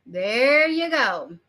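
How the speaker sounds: THERE stretched long, very slow "there you go"; drawn-out emphasis. Real, not acted. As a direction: draw out THERE to drive the point home. Repeats it slowly to drive point home.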